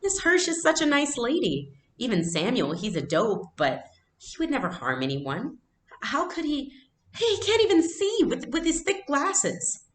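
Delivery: put-on a young girl's high voice, rising in protest; indignant girl defending her neighbors; upset young girl. Anne-Marie upset, pleading.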